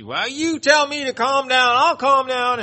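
high, loud, indignant woman's snap; imitating a stoned woman yelling back; mock angry retort. Retold angry woman in waiting room.

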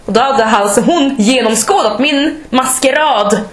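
ordinary delivery

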